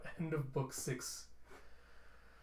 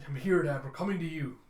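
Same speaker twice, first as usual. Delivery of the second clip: calling back; 2 semitones higher, 6 dB louder than usual. Acted. Daughter answers father in battle.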